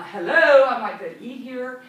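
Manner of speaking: exaggerated loud diner's greeting; calling out. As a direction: call out pointedly to get noticed. Acted annoyed diner calling to hostess.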